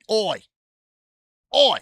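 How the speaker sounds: high, loud Aussie "Oi!"; Aussie shout. Acted. Streamer calling out to the Aussie-lover, short Aussie shout. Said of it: "Oi, it's Aussie".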